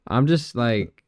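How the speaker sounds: ordinary delivery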